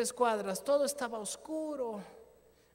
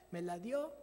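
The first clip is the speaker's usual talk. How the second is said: ordinary delivery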